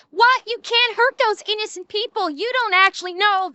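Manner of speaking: mock the shrill whining hippies; whiny protester. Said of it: "reeing hippies that are going".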